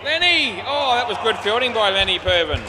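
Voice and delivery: loud, high cheer for the fielder; excited shout. Real, not acted. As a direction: call out in delight.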